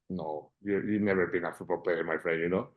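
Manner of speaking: a hint of a dry, unconvinced coach sizing him up; doubtful manager. Manager eyeing the chubby long-haired player, mildly skeptical.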